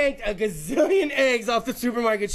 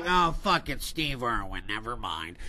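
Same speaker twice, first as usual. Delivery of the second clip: deep, quieter elephant voice giving up; 12 semitones lower, 4 dB quieter than usual. Retold or imitated. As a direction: voice the elephant backing off, dropped low. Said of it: "That elephant would be like".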